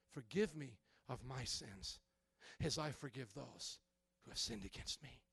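hushed repentant prayer; humble confession. Retold or imitated. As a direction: confess quietly before God. Pastor voices a repentant prayer.

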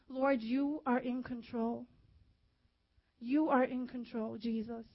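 soft, slow prayer to the Lord; quiet prayer. Acted. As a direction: hand it over to Jesus gently. Prays aloud to the Lord.

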